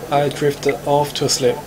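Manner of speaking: normal talking voice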